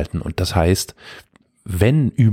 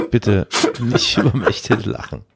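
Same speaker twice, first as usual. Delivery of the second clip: stifled giggles; 3 semitones higher than usual. Real, not acted. Cracking up at listener letter.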